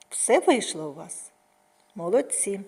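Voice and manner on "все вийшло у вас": ordinary delivery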